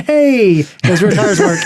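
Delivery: loud, high drawn-out "hey"; playful exclamation of sudden discovery; mock surprised discovery. Acts out noticing tires grip.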